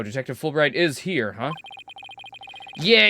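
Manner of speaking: ordinary talk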